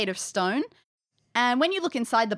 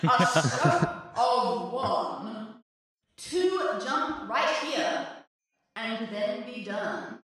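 slow, fading fortune teller voice; mystic voice; 3 dB quieter, much slower than usual. Acted. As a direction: intone it like a mysterious fortune teller. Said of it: "in a cool, like, mystic voice".

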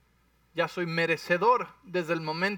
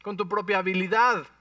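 indignant Paul; 3 semitones higher, 4 dB louder than usual. Acted. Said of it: "y les dice".

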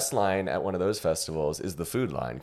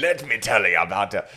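botched German accent sliding into pirate. Shown as a demonstration. Co-host retrying after the pirate remark, bad German-pirate accent. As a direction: do German but land on pirate. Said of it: "That was a pirate".

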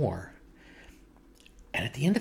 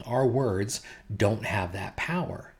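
ordinary talk

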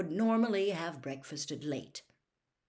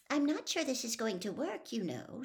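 put-on higher-pitched cautioning girl; lightly voiced warning, pitched up; 5 semitones higher than usual. A narrator's reading. Hermione doubting the twins' plan, warning. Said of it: "said Hermione warningly".

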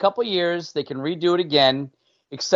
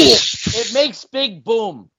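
caveman voice; 6 semitones higher, 5 dB louder, much slower than usual. Pretend. Caveman-style joke line.

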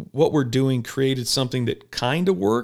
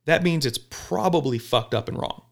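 normal talking voice

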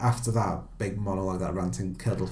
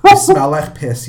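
ranting insult; 4 semitones higher, 13 dB louder, slower than usual. Retold or imitated. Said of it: "he says".

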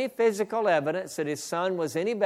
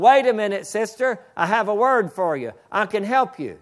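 higher, louder call over her cries; 5 semitones higher, 6 dB louder than usual. Retold or imitated. Preacher calling to the sobbing woman a second time, louder. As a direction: raise the voice to cut through her crying. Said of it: "I said the second time".

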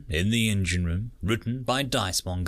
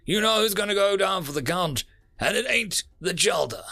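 boastful pilot; 9 semitones higher than usual. Acted. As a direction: brag about flying skill. Blue Wolf boasts.

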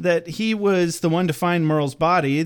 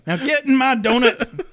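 laughing; 8 semitones higher than usual. Retold or imitated. Angel line, laughing.

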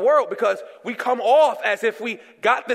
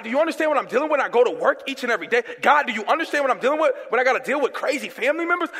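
fast, pleading questions to God; 3 semitones higher, faster than usual. Retold or imitated. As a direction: rush the complaints to God. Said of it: "I'll be sitting there".

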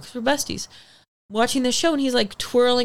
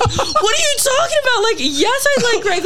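much higher and louder, squealing in disbelief; high squealing disbelief at the roommate; incredulous squeal; 4 dB louder than usual. Retold or imitated. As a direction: shriek it like it's obvious.

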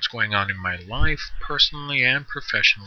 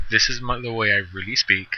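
ordinary talk

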